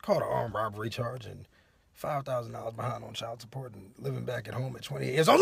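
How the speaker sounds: low, quiet rundown; low, hushed confession; sheepish aside. Real, not acted. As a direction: admit it under your breath. Mumbled confession of his faults.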